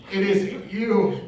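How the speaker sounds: laughing through the words, slow; slow, laughing punchline. Real, not acted. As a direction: deliver the punchline mid-laugh.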